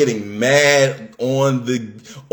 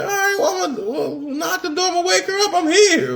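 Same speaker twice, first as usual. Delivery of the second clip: quoting himself much higher, half laughing; high nervous self; faster than usual. Retold or imitated. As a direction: play your younger self, pitched up and eager.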